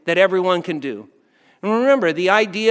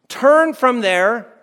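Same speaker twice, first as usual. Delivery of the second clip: drawn out; 5 semitones higher, 5 dB louder, much slower than usual. Acted. Preacher stretches the hard word.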